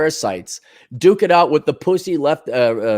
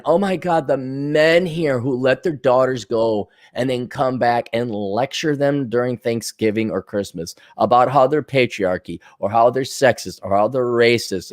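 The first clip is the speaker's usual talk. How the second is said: ordinary talk, nothing marked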